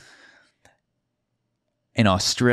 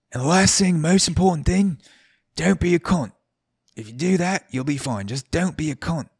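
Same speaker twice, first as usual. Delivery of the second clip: high-pitched Aussie bartender; hammy Aussie impression, pitched up; terrible Australian accent; 9 semitones higher than usual. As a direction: lay down the last rule in a bad Australian accent. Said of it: "bear with my Australian accent".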